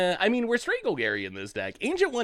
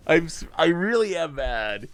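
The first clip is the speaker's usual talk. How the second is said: ordinary talk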